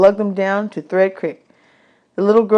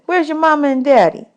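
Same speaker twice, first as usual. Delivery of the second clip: put-on much higher, bossy little girl; 8 semitones higher than usual. Desi quizzing new boys about dishes, high bossy girl voice. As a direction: boss the new boys around.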